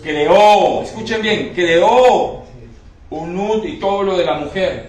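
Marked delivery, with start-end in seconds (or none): 0.0-0.8 creó hit hard and much louder; creó thrown out loud, then falling; punched word. Acted. Preacher hammering the miracle. 1.5-2.4 creó hit hard and much louder, falling; creó boomed out, then dropping; punched word. Acted. Preacher repeats miracle word.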